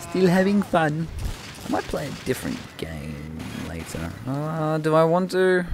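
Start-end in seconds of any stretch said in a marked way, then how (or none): 0.0-1.1 high, drawn-out put-on voice; put-on cheer. Gamer insisting they still enjoy the match, put-on high and slow. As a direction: stretch it out slowly, up high. Repeats own remark in put-on voice.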